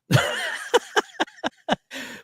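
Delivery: high-pitched burst of laughter. Real, not acted. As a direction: laugh at your own expense. Laughs at own spending.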